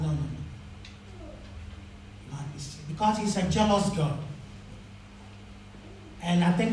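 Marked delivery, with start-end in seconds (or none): none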